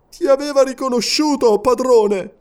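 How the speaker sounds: high, strained voice of a deformed servant; a plain rough reply; brute servant. A narrator's reading. Servant to his master, tense and grim.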